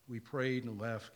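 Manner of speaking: normal talking voice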